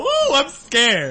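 high, slow mocking scared whine; mock fear. Teasing friend mocks scared whine. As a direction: fake fright, drawn out and high. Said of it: "and then you go".

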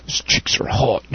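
hushed, sniggering cartoon slacker voice; Beavis impression. Comic does impressions for the podcast, sniggering slacker voice. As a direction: snigger it like a dim cartoon teen. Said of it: "Impressions, everybody".

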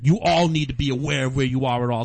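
高めの声で強く叱りつけるボストン訛り; ボストン訛りで列全体を説教する; loud Boston scolding. Retold or imitated. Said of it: "proceeded to tell off the whole line".

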